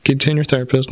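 put-on high, gentle old-lady advice; sweet little old lady. Counselor brushing it off, sweet old lady voice. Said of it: "a sweet little old lady".

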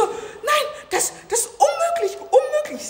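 imitating an outraged AfD politician, high and laughing; mock outraged politician. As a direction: play the indignant politician, hammed up. Beatrix von Storch voiced, upset.

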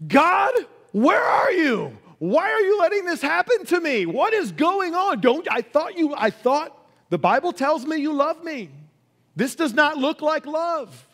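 high, anguished cry to heaven; accusing God. Acted. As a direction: act out a desperate, panicked complaint to God. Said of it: "Can you imagine accusing God Almighty?".

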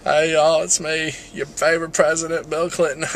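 folksy Southern president impression; Bill Clinton. President introducing himself to everyone, Southern politician impression. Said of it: "I'm gonna do my Bill Clinton".

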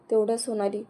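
ordinary delivery, nothing marked

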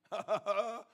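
brief knowing laugh; short chuckle. Real, not acted. Preacher on what seeking takes, short chuckle. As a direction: chuckle briefly between phrases.

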